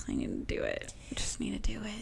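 soft near-whisper, scolding herself; whispered self-reproach. Real, not acted. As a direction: breathe the words out, chiding herself.

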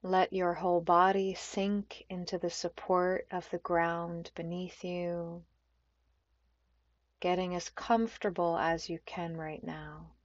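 unhurried meditation guide with long pauses; calm, spacious yoga nidra voice; guided meditation. Acted. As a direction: ease them gently into stillness, phrase by phrase.

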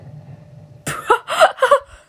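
high unvoiced cackle; shrieking laugh. Real, not acted. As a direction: burst into squealing laughter. Laughs as player explodes.

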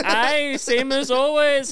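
put-on loud, very high, stretched Puerto Rican-accented cry; imitating crush's accent. Crush ribbing her regular, mimicked loud Puerto Rican accent. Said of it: "doing her voice".

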